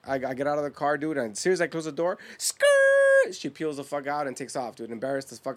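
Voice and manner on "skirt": high, loud tire screech; long piercing mouthed skid noise; screeching tires imitation. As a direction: squeal like a car peeling out. Imitates car tires peeling out.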